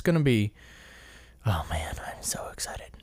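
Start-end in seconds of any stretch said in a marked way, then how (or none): 1.5-2.9 low, hushed excited whisper. Real, not acted. Fan confides how psyched they are for the race, excited whisper. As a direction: whisper it, barely containing the thrill.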